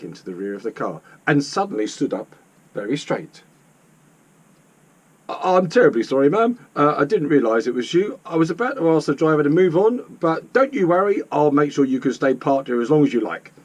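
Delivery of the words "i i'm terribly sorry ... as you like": loud, flustered policeman, stumbling at first; apologetic policeman. Acted. Policeman realises who is in the car, flustered and apologetic. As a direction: apologise hastily to someone important.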